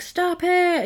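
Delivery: retold angry outburst, half-acted; mock angry yell. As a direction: snap it out like a furious teen. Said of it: "gets really mad".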